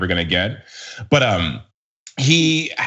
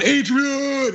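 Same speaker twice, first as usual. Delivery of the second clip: high, lightly voiced Rocky cry; Rocky impression; 14 semitones higher than usual. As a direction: call out Adrian like Rocky. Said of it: "the whole Rocky thing".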